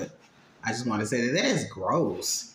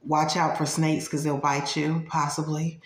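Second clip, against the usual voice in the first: usual speaking voice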